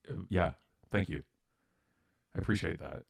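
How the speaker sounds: soft, hesitant gratitude; retold quiet thank-you, halting; humbled, softly spoken reply; subdued thanks. Shaken worker thanks supportive manager.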